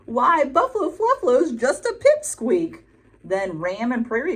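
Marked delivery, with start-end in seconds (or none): 0.1-2.8 high, teasing crow voice; grinning crow. A narrator's reading. Crow teasing the wet buffalo with a grin. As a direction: tease him with a grin. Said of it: "said the crow with a grin on his beak".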